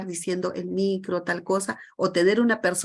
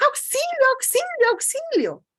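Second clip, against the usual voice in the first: much higher, louder, urgent shouts of help; panicked cry for help; 4 dB louder than usual. Shown as a demonstration. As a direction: scream it like you're really in danger. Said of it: "también con esa misma voz puedo decir".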